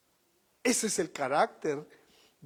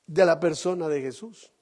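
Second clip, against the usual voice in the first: ordinary delivery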